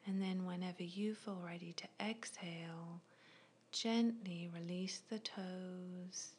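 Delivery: ordinary delivery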